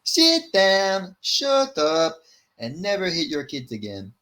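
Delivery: put-on Irish accent slipping at the end; Ian Gary. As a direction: start high in mock Irish, then drop. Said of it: "my Ian Gary type voice".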